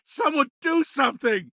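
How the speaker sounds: high, frantic scream for help; desperate plea. Acted. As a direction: cry out helplessly to the crowd. Bystander begs for help.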